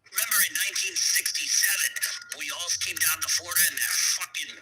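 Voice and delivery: a hammed-up mob uncle; mob cousin. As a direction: boast like a wiseguy. Mob cousin voiced in story.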